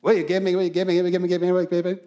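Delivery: rapid auctioneer chant, calling for bids. Shown as a demonstration. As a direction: rattle it off like an auctioneer. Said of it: "how do you talk like an auctioneer".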